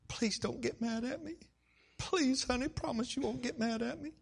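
high, coaxing imitated plea; anxious pleading. Wife coaxing husband not to get mad, pleading. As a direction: beg gently, like a nervous wife.

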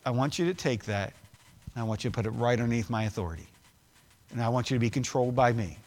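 low, soft and quick, as if God speaks intimately; hushed voice of God. Acted. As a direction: drop the voice low and quiet for God's words. Pastor voicing God's words.

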